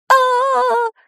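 very high-pitched wail; high drawn-out "aaah" howl. A narrator's reading. Boy buried under a pile of undead, howling. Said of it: "взвыл Шурасик".

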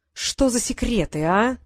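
ordinary delivery

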